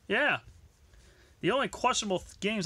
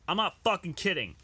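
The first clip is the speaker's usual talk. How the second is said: ordinary talk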